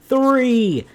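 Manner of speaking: loud, high, drawn-out fake hype; mock excitement. Host hyping a kids' movie sequel, mock excitement. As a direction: put on over-the-top enthusiasm, stretching each word. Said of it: "Can you feel the excitement reverberating off of my voice?".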